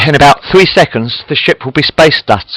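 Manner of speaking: ordinary delivery, nothing marked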